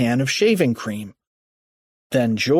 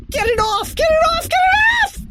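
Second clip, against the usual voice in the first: high shriek, climbing each time; frantic scream; 3 dB louder, faster than usual. A narrator's reading. Finkstein swatting foam off legs, high frantic scream. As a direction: scream it in escalating panic. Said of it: "screamed Finkstein".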